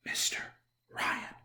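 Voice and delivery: barely whispered name; quiet start. Shown as a demonstration. Reader starting his theme song in a whisper. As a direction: breathe the name out softly. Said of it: "starting quietly and getting louder".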